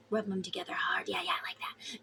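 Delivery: voiceless, teasing whisper; mock whisper. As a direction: whisper it like a playful secret. Joking whisper during the ritual.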